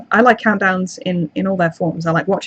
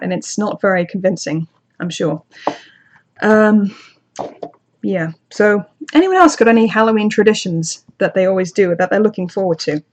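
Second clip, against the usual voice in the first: ordinary talk, nothing marked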